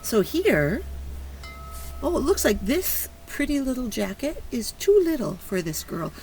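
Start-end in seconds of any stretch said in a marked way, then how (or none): none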